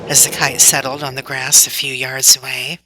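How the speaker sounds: plain reading voice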